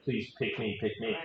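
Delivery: small, quiet mock plea; mock desperation. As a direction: softly imitate desperate pleading. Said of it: "They hear desperation".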